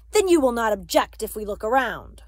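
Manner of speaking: loud, forceful order posed as question; harsh. A narrator's reading. Soldier announcing a search to Papa, harshly. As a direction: come down hard, allowing no refusal. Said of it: "The voice was harsh".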